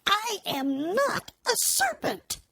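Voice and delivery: high, slow indignant protest. Acted. Alice denying she is a serpent, indignant. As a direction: stress not, offended. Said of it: "said Alice indignantly".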